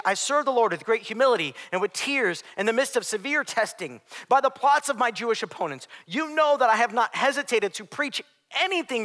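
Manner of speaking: loud, high scripture proclamation; full-force preaching. Acted.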